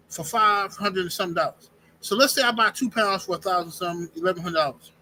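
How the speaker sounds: normal talking voice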